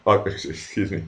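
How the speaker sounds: normal talking voice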